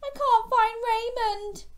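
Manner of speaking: high, loud worried cry; young shark upset, calling to his mum; upset child. A narrator's reading. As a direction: fret to Mum. Gilbert's panicked cry to Mum.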